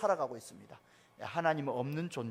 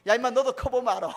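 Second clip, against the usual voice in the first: chuckling, slightly louder dad's brush-off; laughing retort; 5 semitones higher, 7 dB louder than usual. Retold or imitated. Father brushing off his daughter, laughing.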